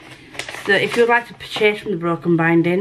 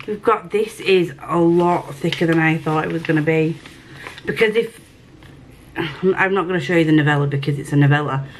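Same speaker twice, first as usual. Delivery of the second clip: ordinary delivery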